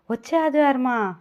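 ordinary delivery, nothing marked

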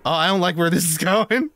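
nervous giggling worry; nervous laugh. Real, not acted. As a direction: laugh through dread. Game turning against him.

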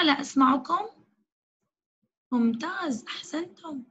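usual speaking voice

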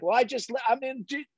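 high, stammering protest cut short; acted helpless sputter; flustered retold reply. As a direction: play the cornered employee trying to explain. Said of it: "I was like".

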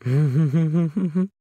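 a sudden high, eerie machine laugh; uncharacteristic laugh. A narrator's reading. The home assistant laughing at her tenant, eerie high laugh. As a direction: laugh out of character, unsettling and high. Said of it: "then uncharacteristically laughed".

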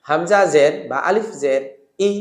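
ordinary talk, nothing marked

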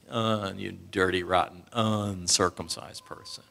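low, soft, sneering put-down; nasty insult. Retold or imitated. Outsiders sneering at the uncircumcised, low contemptuous voice. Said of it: "And it wasn't nice".

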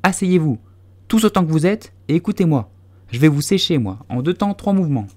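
high, loud mouse giving orders; loud command. A narrator's reading. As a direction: order them all to sit down. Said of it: "ordonna d'une voix forte".

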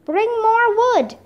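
high, stretched-out order; storyteller's moderate, drawn-out shout; narrated shout. A narrator's reading. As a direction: call it slowly across the room. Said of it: "Leonardo shouted".

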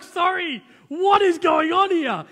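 can't believe what's happening in court; incredulous outburst. Retold or imitated. To the judge in court, a loud incredulous outburst. Retelling surprise at the objection.

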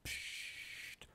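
scharfes stimmloses Psst; zischendes Psst. A narrator's reading. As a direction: bring sie zum Schweigen. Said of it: "macht Madame Demüt". (Madame Demüt zischt psst)

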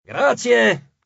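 a high, loud mocking thanks; shouted thanks. A narrator's reading. The tyrant Ippia shouting back at Antino, loud and high. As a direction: call it out cheekily. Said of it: "gridò Ippia".

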